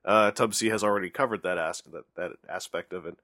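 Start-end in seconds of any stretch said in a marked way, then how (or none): none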